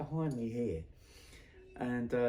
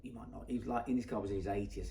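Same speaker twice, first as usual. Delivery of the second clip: ordinary delivery, nothing marked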